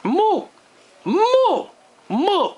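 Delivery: loud high mooing cow. Pretend. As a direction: moo like a cow. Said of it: "I summon the moo cow".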